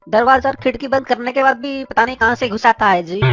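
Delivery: singsong South Indian Hindi with a lilt; South Indian accent. Acted. Neighbour Anna calls Billa at night, in a South Indian accent.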